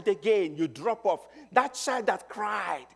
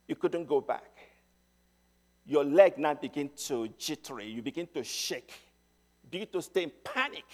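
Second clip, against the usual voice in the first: ordinary talk, nothing marked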